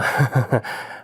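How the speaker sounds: short sheepish laugh; placating laugh. Acted. Husband laughs nervously to calm his angry wife, sheepish laugh. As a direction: laugh it off to soothe her. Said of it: "그렇게 웃지 마".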